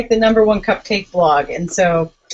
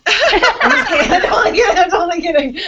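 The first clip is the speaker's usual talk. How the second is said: laughing; 9 semitones higher, 3 dB louder than usual. Real, not acted. Teasing Renee, laughs through it.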